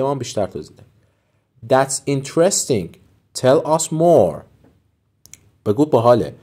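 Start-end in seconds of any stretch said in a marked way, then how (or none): 1.7-4.4 slow, clear teacher read; slow model phrase. Shown as a demonstration. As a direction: lay it out slowly for learners. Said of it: "teacher models phrase".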